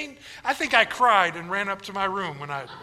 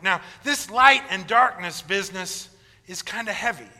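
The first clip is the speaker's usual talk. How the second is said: usual speaking voice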